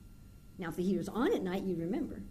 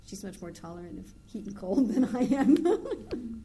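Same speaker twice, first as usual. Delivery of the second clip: laughing; 5 semitones higher, 6 dB louder, slower than usual. Real, not acted. Amused remark, laugh.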